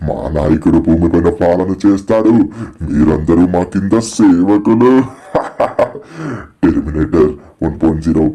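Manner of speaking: loom over the captives, gloating; menacing villain. Acted. Villain Terminator gloating over kidnapped Shinchan, low menace.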